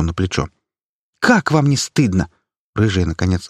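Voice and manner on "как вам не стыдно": shaming. Acted. Activist shaming granny.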